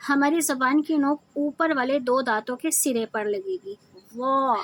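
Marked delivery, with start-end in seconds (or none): 4.1-4.6 thick "وا" as emphatic z, tongue tip on upper teeth; mouth-filling Zaa. Shown as a demonstration. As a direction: say "وا" full-mouthed, tongue back raised. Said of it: "منہ بھر کر اسے ادا". ("وا" موٹا ظا، منہ بھر کر)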